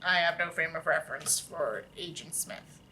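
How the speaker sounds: quiet aside. Real, not acted. Narrator confessing to listeners in a soft low deadpan. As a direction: drop it in as an aside.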